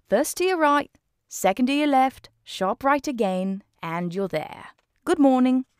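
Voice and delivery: high, bright British bobby; policeman voice. Acted. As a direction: play the helpful policeman giving directions.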